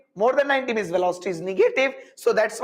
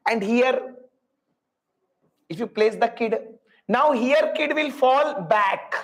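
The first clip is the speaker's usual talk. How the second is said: ordinary talk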